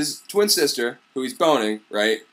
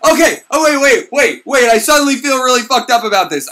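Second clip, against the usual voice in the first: panicky; 6 semitones higher, 6 dB louder than usual. Retold or imitated. Sieglind panicking.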